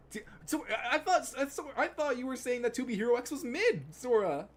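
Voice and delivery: high, loud, flustered protest; worked-up, high-pitched yell; indignant outburst. Real, not acted. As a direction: protest loudly to a friend. Outburst over a misunderstanding with Sora.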